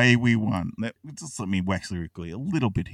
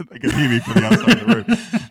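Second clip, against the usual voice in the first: words spoken through laughter, louder and higher; laughing through the line; laughing while talking; 6 semitones higher, 8 dB louder than usual. Real, not acted. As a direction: talk as the laugh spills over. Laughing at his own story.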